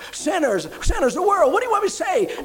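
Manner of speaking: pushed to the edge, snapping back; exasperated. Retold or imitated. Woman pressed with the same question.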